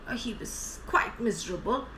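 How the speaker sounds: ordinary delivery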